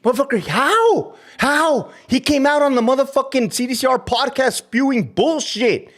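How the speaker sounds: high, heated outburst; angry rant. Real, not acted. Ex-guard ranting at a colleague who went on a podcast, heated. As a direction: vent your fury at him.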